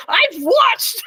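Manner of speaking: high-pitched mock yell; retold yelling. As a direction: imitate an indignant fan's protest. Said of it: "She's yelling at me".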